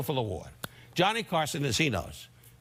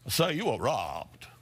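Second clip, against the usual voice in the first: imitating the police officer. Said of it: "a guy with a police cap ... going".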